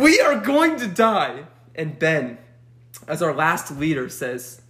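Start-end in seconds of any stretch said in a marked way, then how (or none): 0.0-1.5 high, slow, drawn-out wail; hammed-up despair, each word stretched; mock doom. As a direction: spell out the dread in a raised voice. Acted doom among scared kids.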